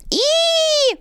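high, sharp hissing "Ih"; disgusted hiss. Acted. Cat lady hisses at the sneezing kitten, high and disgusted. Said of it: "fauchte es aus einer anderen Ecke".

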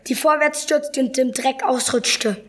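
plain reading voice

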